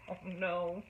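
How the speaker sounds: ordinary delivery, nothing marked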